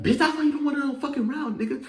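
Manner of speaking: high, loud, rapid outburst; retold taunt. Taunting the other fighter after being held back, loud rapid squeal. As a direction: fire it off in a squeal of provocation.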